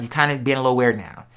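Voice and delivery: usual speaking voice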